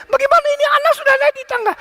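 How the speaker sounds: high, fast imitation of a frantic grandmother; panicked yelling. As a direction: shriek in mock panic, rushing the words. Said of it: "Waktu itu terus dia teriak". (tiruan teriakan panik nenek, tinggi dan cepat)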